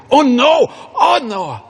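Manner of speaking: loud, very high mock protest; grandma refusing. Grandson offers a dress, she squeals no.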